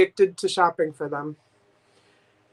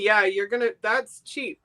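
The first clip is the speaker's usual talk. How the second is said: ordinary talk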